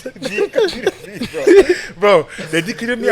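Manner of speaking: high-pitched, laughing through the words. Real, not acted. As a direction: crack up while telling it. Laughing as he recalls the hit.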